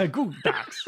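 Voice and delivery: lightly imitated star catchphrase; Christopher Walken echo. Friend copying the impression, light Walken echo. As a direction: echo the bad impression back. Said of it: "my terrible Christopher Walken impression".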